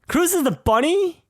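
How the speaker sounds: high-pitched shocked double take; astonished disbelief. Real, not acted. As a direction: blurt it out in real amazement, pitch leaping up. Repeats the discovery in astonishment.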